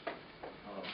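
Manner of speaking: emotional breath catch; a shaky breath mid-sentence; catching breath midword. Real, not acted. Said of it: "I'm emotional about this".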